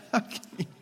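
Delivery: mock outrage at gas station coffee; incredulous. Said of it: "I'm like".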